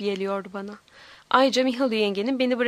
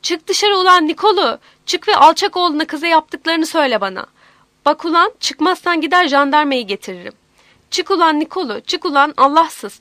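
loud, raised confrontational yell; furious shouting; 3 semitones higher, 7 dB louder than usual. A narrator's reading. Aunt running to the door to confront Nikolu, shouting. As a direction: shout them out of the house. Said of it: "Kapıya doğru koştu ve bağırarak".

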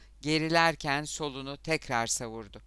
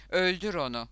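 crowd shouting; 4 semitones higher, 6 dB louder than usual. A narrator's reading. Crew egging on their boy in the fight, shouting. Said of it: "diye bağırdılar".

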